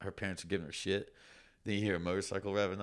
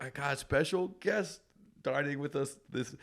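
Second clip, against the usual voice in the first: put-on a light British accent, a bit higher, like a TV chef; 7 semitones higher than usual. Chef announcing a special guest to diners, mild British accent. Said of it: "that's my British accent".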